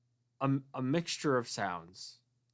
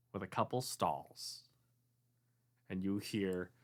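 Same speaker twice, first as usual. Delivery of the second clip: normal talking voice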